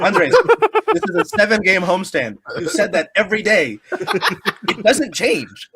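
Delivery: high, loud, laughing exasperation, slowing at the end; high giggling mock complaint; laughing protest. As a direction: laugh while pleading with Andres. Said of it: "And I was like".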